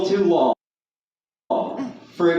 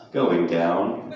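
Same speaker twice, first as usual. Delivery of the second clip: much lower, sultry elevator voice; sensual voice; 13 semitones lower than usual. Acted. Comic imitates an elevator announcing its floor, sensual voice. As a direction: purr it low like a seductive elevator. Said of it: "it said in a sensual voice".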